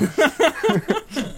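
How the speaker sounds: a high laugh breaking out at his own silly accent; real self-mocking laugh; amused laugh. Real, not acted. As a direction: laugh at yourself, high and bright.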